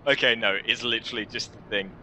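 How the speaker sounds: usual speaking voice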